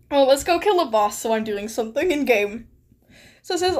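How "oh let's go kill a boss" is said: usual speaking voice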